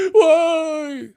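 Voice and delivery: high, loud whiny mock voice; squeaky parody with a laugh; mocking sensitive fan. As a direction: whine it like a touchy fan. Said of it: "they so sensitive".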